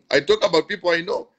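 amused, chuckling as he says "I talk about people I know"; laughing. Real, not acted. Pastor on his live stream, laughing at his own point. Repeats line, laughing on second take.

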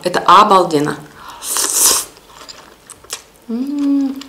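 slurping noodles while talking; chewing with noodles hanging; mouth full of pasta. Real, not acted. Seen 0: Pasta strands hang from her lips, fork at mouth throughout.

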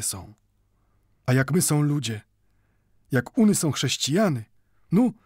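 proud and unafraid, every word ringing; firm ringing voice. A narrator's reading. Old Mendel telling neighbours he will not hide behind a cross. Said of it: "mówił twardym, brzmiącym jak dzwon głosem".

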